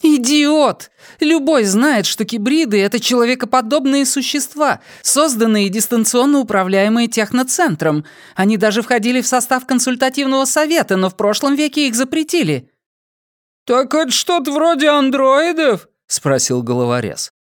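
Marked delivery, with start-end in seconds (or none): 0.0-12.7 high, cool woman's voice; Dayana's voice. Acted. Dayana questioning the drugged prisoner, high and cold. 13.6-15.9 high, almost female voice. Acted. Dim henchman asking a question, high almost female voice.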